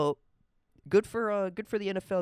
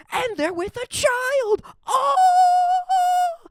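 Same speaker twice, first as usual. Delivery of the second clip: rising from higher and louder to a shrieking scream; hammed-up reveal ending in a scream; mock shock building to scream; 9 dB louder, slower than usual. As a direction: build the horror until you scream. Imagined shocked reveal of Epstein.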